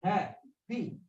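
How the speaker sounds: "Happy" broken into ha, pause, pee; syllable by syllable. Shown as a demonstration. Teacher asking about this word, syllable by syllable. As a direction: softly split "Happy" into two separate beats. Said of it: "Two syllables".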